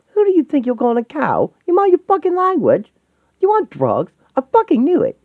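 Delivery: high, loud indignant outburst; outraged scolding. Acted. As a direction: lash out, pitch leaping up. Takes offence and accuses the other.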